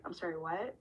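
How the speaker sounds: as if the floor dropped away; stunned disbelief. Retold or imitated. Answering the boss's bad news, soft and stunned.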